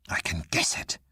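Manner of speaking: sharp unvoiced hiss. A narrator's reading. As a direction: spit it out in a whisper. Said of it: "hissed Syn".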